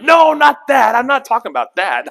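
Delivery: sudden high, loud protesting cry; mock-panicked high protest to God. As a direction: blurt it out high and loud, caught out. Congregant protesting to God, acted.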